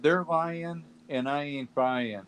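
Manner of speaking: ordinary talk, nothing marked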